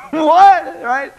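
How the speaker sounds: high, loud laughing outburst; laughing what. Real, not acted. Laughing loud at his own turkey joke.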